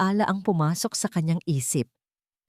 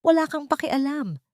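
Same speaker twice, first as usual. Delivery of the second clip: higher, mild mock shout of a girl; runaway girl defying Hiraya, in a mild higher shout; child shouting; 9 semitones higher than usual. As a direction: snap it like an angry kid. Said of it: "sigaw ni Liab".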